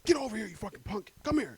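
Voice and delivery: bully him like a power-tripping cop. Acted. Acting the racist cop's order.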